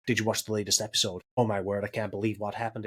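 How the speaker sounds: lightly put-on American accent, low and quick. Imagined workmates chatting about last night's episode, American accent. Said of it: "everyone at work is American now".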